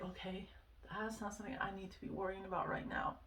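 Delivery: soft, hushed inner voice, a bit fast; calm yourself down under your breath; quiet self-talk. Retold or imitated. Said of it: "it started to help me kind of be like".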